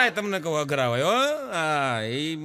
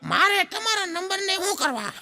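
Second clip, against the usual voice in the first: put-on much higher cheeky boy; child voice; 12 semitones higher than usual. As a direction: talk back like a kid. Boy answers teacher. (શિક્ષકને સામો જવાબ આપતો છોકરો, ઊંચા અવાજે)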